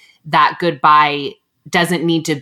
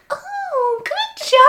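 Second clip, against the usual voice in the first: put-on very high cooing baby voice; mocking baby talk; much slower than usual. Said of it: "talked in a baby voice".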